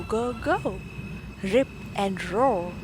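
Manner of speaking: usual speaking voice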